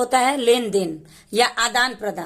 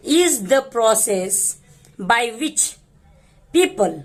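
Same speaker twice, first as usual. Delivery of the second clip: very slow, phrase by phrase with pauses; slow demonstration; much slower than usual. Shown as a demonstration. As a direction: read it slowly so learners catch each word. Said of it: "मैं धीरे धीरे करके बताऊंगी".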